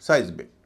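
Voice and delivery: seismic as "SIZE-mik", firmly with a falling end; correct pronunciation. Shown as a demonstration. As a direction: repeat seismic with a loud "size". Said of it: "इसका उच्चारण". (seismic, ज़ोर से "साइज़मिक")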